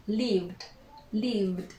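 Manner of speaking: "lived" softly, ending V-D; one-syllable "lived" with a D at the end; silent E. Shown as a demonstration. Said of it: "We do not pronounce the E".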